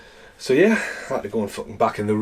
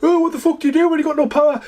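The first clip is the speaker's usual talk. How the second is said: high, fast mock panic, much higher than his own voice; mocking panicked people; 3 dB louder, faster than usual. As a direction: squeal it like a helpless whiner with no idea. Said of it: "Everyone's like".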